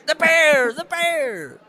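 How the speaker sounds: high mock shriek, starting loud; hammed-up frantic repeating; manic insistence. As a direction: squawk it obsessively.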